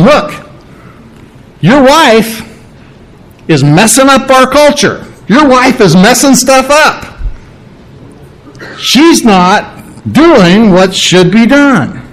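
high-pitched, exasperated griping about the wife; complaining relatives. Pretend. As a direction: whine to the father that his wife broke custom.

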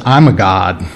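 low-pitched voice of a cat declaring itself divine; self-important cat. Acted. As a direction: play a haughty cat, dropping the pitch well down. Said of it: "the cat looks at you and says".

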